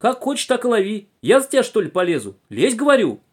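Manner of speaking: high, loud exasperated order; tearful scolding shout. A narrator's reading. Conductress orders boy to catch the viper, shouting tearfully. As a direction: order him under in wailing exasperation. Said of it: "закричала она плачущим голосом".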